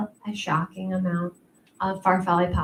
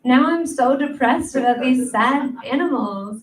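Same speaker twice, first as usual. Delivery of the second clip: mock dismay; 5 semitones higher than usual. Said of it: "all of a sudden you're like".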